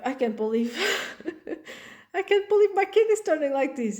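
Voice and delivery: a parent who can't believe it, laughing; laughing disbelief. Retold or imitated. Shocked parent on child's turning, laughing disbelief.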